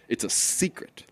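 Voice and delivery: hushed, playful whisper; confiding mock-secret whisper; secretive whisper.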